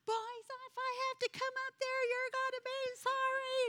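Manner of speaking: very high, strained mock threat; retold threatening scream. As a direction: shriek the warning up the stairs, imitating. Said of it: "I was screaming at them".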